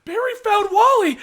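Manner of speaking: high-pitched breathless announcement; excited news. Retold or imitated. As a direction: squeal the news. Excitedly explaining to wife.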